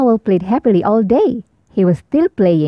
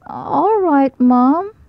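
a touch of a sniffly, higher owlet; Baby Owl agreeing to his mom, higher and tearful; tearful giving in; 6 semitones higher, much slower than usual. A narrator's reading. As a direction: give in with a little cry.

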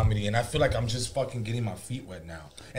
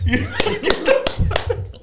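laughing; 6 dB louder, much slower than usual. Real, not acted. Laughing at own panic story.

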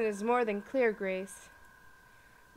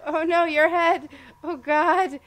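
loud, high horrified oh no; shocked outcry; 5 semitones higher, 15 dB louder than usual. Real, not acted. Player sees the deer's head is off, a loud shocked cry. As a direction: recoil in shock.

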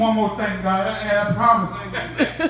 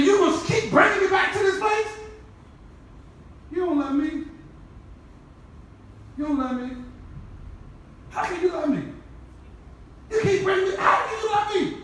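impassioned preaching; 5 semitones higher than usual. Acted. Preaching confrontation.

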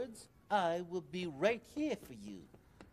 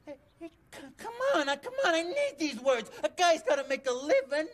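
high, fast, crying plea with a break in the voice; whiny begging; 8 semitones higher, 4 dB louder, faster than usual. Acted. Smelly begs Maria to let him keep his words, high whiny crying plea. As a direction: whimper and plead not to lose them.

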